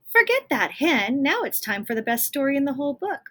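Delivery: loud, eager and fast; excited Jack. Acted. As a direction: burst in full of excitement.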